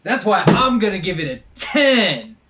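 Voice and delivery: loud, high, emphatic announcement; grand proclamation. Acted. Reviewer announcing his top rating, loud and grand. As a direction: proclaim the score with a long, booming TEN.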